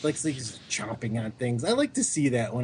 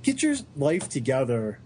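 ordinary talk

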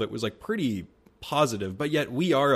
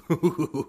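short surprised laugh; little wowed chuckle; an approving laugh at the question; impressed laugh; 5 semitones higher than usual. Real, not acted. Reacting to deep question.

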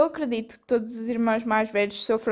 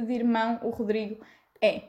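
ordinary talk, nothing marked

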